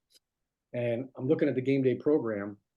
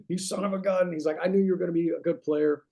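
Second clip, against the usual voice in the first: 1 amazed coach; 6 semitones higher, 4 dB louder than usual. Retold or imitated. Coach loses it laughing.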